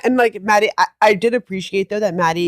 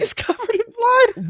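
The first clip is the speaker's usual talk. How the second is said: pained cry; 14 semitones higher than usual. Real, not acted. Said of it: "like, blood".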